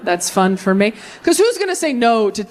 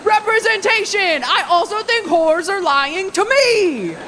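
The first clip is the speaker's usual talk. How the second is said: high, drawn-out triumphant cry; vindicated men; 11 semitones higher, slower than usual. Acted. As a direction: cheer as if at last understood. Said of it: "all the men were like".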